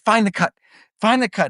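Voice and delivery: high, urgent orders; urgent teacher. Retold or imitated. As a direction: imitate the teacher frantically searching. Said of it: "She's like".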